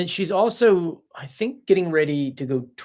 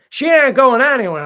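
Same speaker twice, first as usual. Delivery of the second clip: raised, much louder voice; loud insistence; 8 semitones higher, 10 dB louder than usual. Real, not acted. Host insisting to viewers about Pearl, loud emphatic repeat. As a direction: hammer it home, loud and high.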